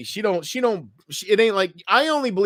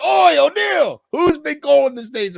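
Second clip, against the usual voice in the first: loud, high Australian shout; Australian yell; 13 semitones higher, 7 dB louder than usual. Retold or imitated. Quoting a Simpsons episode, loud Australian yell. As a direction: bark it like an angry Aussie. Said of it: "Simpsons episode".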